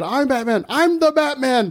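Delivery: high hammed-up Batman line; Batman impression. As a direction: announce it grandly.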